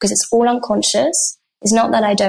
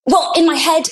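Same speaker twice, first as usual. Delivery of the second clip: acting out a cross partner, higher; 7 semitones higher than usual. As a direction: imitate an upset partner's retort, pitched up. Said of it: "and he's really cross".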